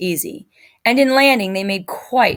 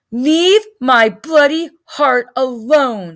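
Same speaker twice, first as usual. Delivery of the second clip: defiant; 4 semitones higher, 5 dB louder, slower than usual. Acted. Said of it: "chin was sticking out defiantly".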